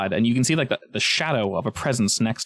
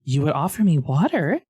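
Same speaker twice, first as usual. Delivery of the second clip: higher-pitched snake, mock touched surprise; talking snake; 5 semitones higher than usual. Snake is offered the waterskin, higher questioning creature voice. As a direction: ask as a flattered snake, pitched up.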